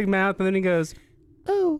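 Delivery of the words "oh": high, loud, pursed little oh; tiny-mouthed oh. Shown as a demonstration. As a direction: purse the lips tight for the punchline. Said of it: "Like, with a small mouth".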